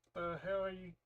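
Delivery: high-pitched mock bewilderment; confused. Mother reacting to the kids' strange explanation, confused. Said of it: "this confused look on her face, like".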